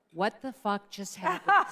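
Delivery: slow, stunned, swearing in disbelief; mad disbelief. Retold or imitated. Howard, baffled after the call, in slow stunned disbelief. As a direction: spell out each word like a baffled boss. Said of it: "looked at me like he was mad".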